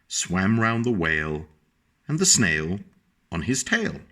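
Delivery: put-on lilting read-aloud voice for a child; sing-song picture-book reading; storybook narration. A narrator's reading.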